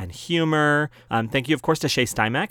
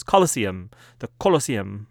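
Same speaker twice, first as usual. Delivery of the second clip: hammed-up German accent on the word; badly faked German pronunciation; terrible German accent; 3 semitones lower than usual. Shown as a demonstration. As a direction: put on a bad German accent. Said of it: "my terrible German accent".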